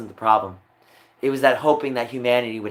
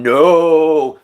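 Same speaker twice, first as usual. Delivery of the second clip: loud no; 4 semitones higher, 9 dB louder than usual. Real, not acted. Exasperated retort to accusers.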